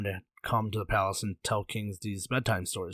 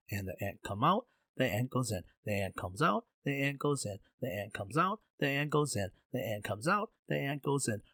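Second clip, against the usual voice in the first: softer, sing-song alternating rise and fall; monotonous droning repetition; 6 dB quieter than usual. Acted. Zha drags out the ant story for King, soft droning repetition. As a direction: lull the listener with an endless soft pattern.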